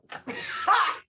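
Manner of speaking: loud, high delighted laugh; high burst of laughter. Real, not acted. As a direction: crack up at the card. Laughing at a card reading.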